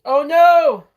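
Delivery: high, loud shriek of mock alarm; hammed-up high loud "oh no!". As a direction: shriek it in fake panic. Mock alarm at movie crash.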